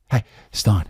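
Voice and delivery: ordinary delivery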